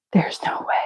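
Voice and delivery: hushed, stunned repeat to herself; disbelieving whisper. Real, not acted. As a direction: whisper "There's no way" under breath. Realizing she repeated a square.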